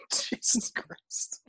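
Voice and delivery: slow breathy whisper. Real, not acted. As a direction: whisper it in disbelief. Reacting after laughing hard.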